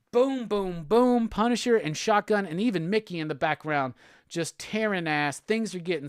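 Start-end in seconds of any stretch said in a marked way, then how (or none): 0.1-1.3 mock gunfire. Imitating gunfire in the comic.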